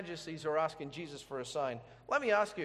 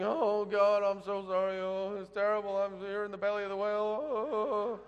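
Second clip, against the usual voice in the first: mock lament; 2 semitones higher than usual. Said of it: "he goes through this whole thing".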